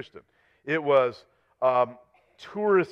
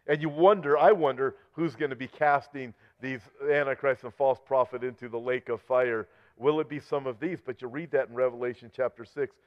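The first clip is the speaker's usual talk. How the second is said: ordinary talk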